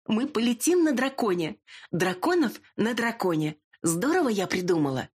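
high-pitched gushing delight; shrill excited squeal. A narrator's reading. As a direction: squeal it like a spoiled girl. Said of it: "противно заверещала".

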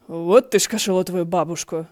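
loud, heated curse; swearing with feeling. A narrator's reading. He Xuan hit by a falling peach, loud cursing. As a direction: blurt the oath out hard. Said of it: "сказал Хэ Сюань с чувством".

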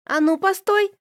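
high sharp call to stop him. A narrator's reading. Elis shouting after the paper boy, high and sharp. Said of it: "крикнула ему Элис".

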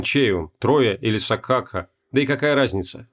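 ordinary delivery

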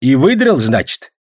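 put-on eager, high-pitched old man's question; pry with a loud, high start; curious grandfather. A narrator's reading. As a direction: inquisitive old peasant. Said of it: "продолжал любопытствовать дед".

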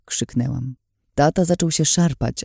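ordinary delivery, nothing marked

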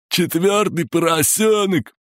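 very high sing-song cry; childish high-pitched taunt; taunting rhyme yell. A narrator's reading. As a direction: announce it in a gleeful high chant. Torturer's next taunting rhyme shout.

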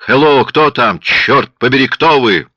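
loud, high alarmed shouting; loud angry calls for an answer. A narrator's reading. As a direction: yell down the phone line, demanding. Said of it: "рявкнул Бомонт".

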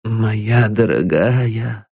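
slow, gentle murmur; tender. Acted. Old father gently soothes his weeping daughter, tender. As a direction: soothe the girl slowly. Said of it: "нежно поднимая свою дочь".

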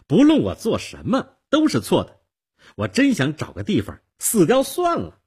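put-on high, loud, aggrieved girl; upset outburst. A narrator's reading. Girl despairs, then cries. (委屈绝望地喊)

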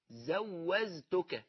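زوزتك with z in place of j, hugely drawn out; very slow زوزتك, both consonants z; j pronounced as z. Shown as a demonstration. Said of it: "بتنطق الجيم زاي". (نطق الجيم زايًا في زوزتك، ببطء شديد)

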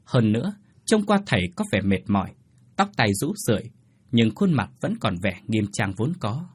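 ordinary delivery

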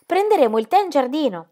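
high-pitched coaxing plea; pleading. A narrator's reading. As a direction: plead with a guest, voice lifted. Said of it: "la pregò Ingeborg".

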